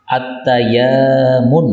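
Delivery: At-tayamun said slowly, ya drawn out long; teacher's slow At-tayamun with a long ya. Shown as a demonstration. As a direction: stretch the ya in At-tayamun. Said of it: "Ya-nya panjang".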